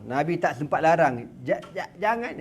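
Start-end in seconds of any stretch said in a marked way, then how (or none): 1.5-2.3 stammering Prophet. Retold or imitated.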